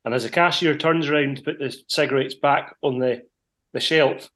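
usual speaking voice

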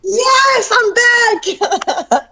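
very high, drawn-out excited squeal; jubilant shout. Real, not acted. Guest announcing she is back, jubilant. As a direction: cry it out high in sheer celebration.

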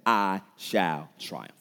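very slow, spaced-out words; deliberate, drawn-out vow; resolute declaration. Shown as a demonstration. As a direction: proclaim it one weighty word at a time. Models what one should say.

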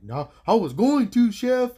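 high, loud mock excited reply; imitating coworkers. Cooks telling the chef about the flasher, loud high mock voice. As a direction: play the line cooks bragging. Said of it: "they're like".

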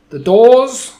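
sudden high, loud witch shout; commanding witch bellow; witch's shout. Acted. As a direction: blast it at the whole room like a witch. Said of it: "shouted the Grand High Witch".

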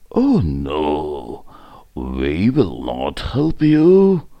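a touch of a big animal saying no; elephant refusal. A narrator's reading. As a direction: refuse in a drawn-out chant.

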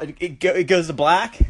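normal talking voice

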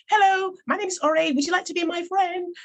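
put-on high-pitched, very English child introduction; singsong English greeting, high and hopeful; English accent. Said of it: "with my English accent".

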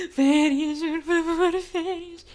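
begging future self. Pretend. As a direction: act your exhausted future self begging for a holiday. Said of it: "já vou estar tipo".

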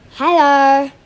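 loud, put-on character hello; Elena. Shown as a demonstration. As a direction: belt out a greeting in an altered voice. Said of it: "Elena's voice".

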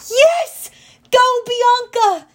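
very high, loud cheer; triumphant, drawn-out shout; cheering yell. A narrator's reading. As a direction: yell encouragement, stretching the words. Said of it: "I yelled".

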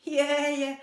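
put-on high, loud girlish giggle; little laugh. Girl laughing at Birlibán, giggly. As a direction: giggle at him. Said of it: "zasmála se holčička".

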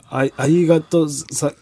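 slow Kagoshima dialect thanks; 鹿児島弁. Shown as a demonstration. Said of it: "わかんねえよ". (鹿児島弁のお礼)

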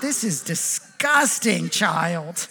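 slow, drawn-out disgust, kept soft; mock disgusted. Dad to child about the dirty cup, disgusted. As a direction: drag out the word in mock revulsion.

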